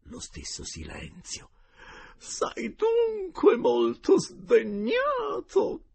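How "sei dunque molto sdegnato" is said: much higher, loud and slow tearful wail; wailing lady. A narrator's reading. Geltrude begging the uncle's spirit, slow high tearful wail. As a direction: plead through tears.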